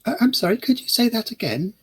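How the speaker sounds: a pardon-me with a lifted, polite voice; asking him to repeat. Acted. Lily asks the old man to repeat himself, high, polite and confused. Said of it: "That's what I literally say".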